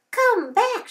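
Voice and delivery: high, loud cry after him; wife's shout. A narrator's reading. Wife yelling after the runaway cookie, high and loud. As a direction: call him back at the top of her voice. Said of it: "shouted his wife".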